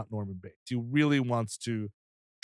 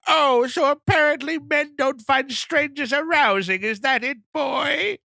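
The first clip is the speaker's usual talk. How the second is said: high, loud old lady impression; Norma Bates; 12 semitones higher, 4 dB louder, slower than usual. Mother taunting her son about strangers, scornful old lady. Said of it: "She's like".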